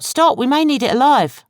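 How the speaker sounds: high, loud call to stop; urgent shout. A narrator's reading. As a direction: yell to halt him. Betsy urgently stopping the sergeant.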